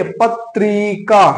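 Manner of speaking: पत्रिका said very slowly, syllable by syllable; पत्रिका stretched slowly for students to hear the ई sound; slow spoken demo. Shown as a demonstration. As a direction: drag out पत्रिका so each sound is heard. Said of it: "बोलकर देखिए".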